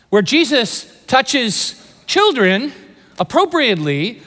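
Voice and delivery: ordinary talk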